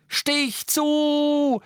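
high loud yell; shout. Acted. Harry screaming at Ron to stab, high loud yell. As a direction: scream the order. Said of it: "schrie Harry".

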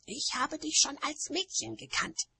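high, peevish witch voice; high-pitched grumble; grumpy. A narrator's reading. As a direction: say it sullenly in a high voice. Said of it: "sagte Nanny verdrießlich".

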